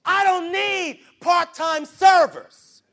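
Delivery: slow, loud, high declaration; preaching shout. Real, not acted. Preacher rebuking the church, slow loud shout. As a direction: hammer every word slowly.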